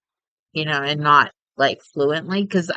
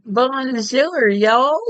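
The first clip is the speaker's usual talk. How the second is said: put-on higher Texan-accented bonjour y'all; a jokey Texan bonjour; mock Texan French; 7 semitones higher, much slower than usual. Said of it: "Texan, right?".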